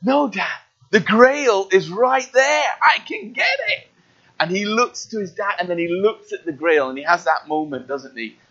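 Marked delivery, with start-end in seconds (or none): 0.0-3.9 rising, higher pleading Indy; slow, reaching plea; straining Indy. Retold or imitated. Preacher as Indy pleading with his dad, rising higher. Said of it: "is like".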